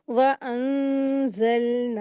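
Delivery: "वअंज़लना" slow, long nasal hum before the z; ikhfa recitation. Shown as a demonstration. Teacher reciting the next verse, slow ghunna recitation. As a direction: draw out "वअंज़लना" with the noon hidden in the nose. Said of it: "नाक में छुपा करके गुन्ना करके पढ़ते".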